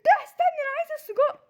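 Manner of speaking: high, loud whiny fake sob; mock crying tantrum. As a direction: throw a comic crying tantrum. Tantrum over the sausage.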